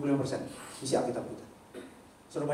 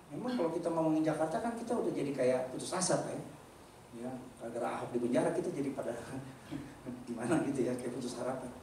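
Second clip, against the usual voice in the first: ordinary delivery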